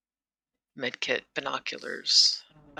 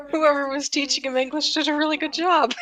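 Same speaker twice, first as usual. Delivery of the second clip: teasing sarcasm; 12 semitones higher, 5 dB louder than usual. Real, not acted. Teasing the Russian's idioms.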